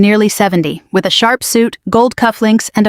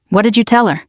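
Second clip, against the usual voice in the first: plain reading voice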